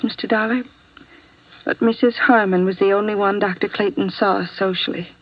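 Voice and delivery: ordinary talk, nothing marked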